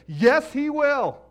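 high, loud, slow insistence; emphatic preaching. Acted. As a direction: drive home every word.

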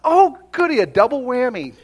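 high, loud gleeful squeal in imitation; mock delight. Older woman learning he is policeman and pastor, mock delight. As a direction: burst out with exaggerated glee.

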